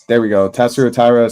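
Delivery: normal talking voice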